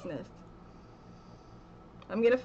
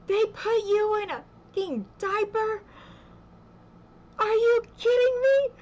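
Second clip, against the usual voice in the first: much higher, louder squeaky mock disbelief; imitating outraged husband; 11 semitones higher, 4 dB louder than usual. Husband hearing she was put in a diaper, imitated high outraged disbelief. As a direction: play the husband losing it, pitched way up. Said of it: "And he's like".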